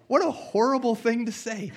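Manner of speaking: adult [name] recalling the Sunday school line, appalled; shocked disbelief. Retold or imitated. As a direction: react like you can't believe someone said that. Adult self reacting to teacher.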